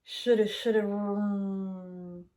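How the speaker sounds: rumbling mock vroom; braking rumble. Plane rumbles on the runway, mock vroom. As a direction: rumble it like brakes.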